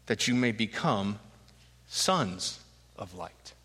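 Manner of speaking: solemn verse read aloud; scripture reading. A narrator's reading.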